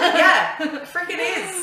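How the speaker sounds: high laughing squeal. Real, not acted. Both laughing at joke.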